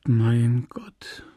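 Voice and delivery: whispered moan. A narrator's reading. Emma to the priest who fails to understand, whispered moan. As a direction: let it fade into a breathy, despairing whisper. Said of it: "stöhnte sie".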